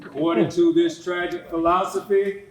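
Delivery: usual speaking voice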